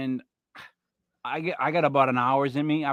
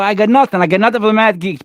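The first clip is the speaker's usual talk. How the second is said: loud, rapid give-up; exasperated shout; 3 semitones higher, 11 dB louder, faster than usual. Real, not acted. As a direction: throw up your hands and yell it out fast. Gives up on the date puzzle.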